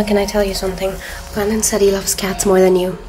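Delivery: ordinary talk, nothing marked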